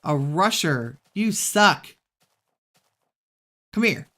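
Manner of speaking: loud annoyed yelling at the mob; fighting yell. Real, not acted. Gamer fighting a rushing mob, loud yelling. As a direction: bark at the enemy mid-fight.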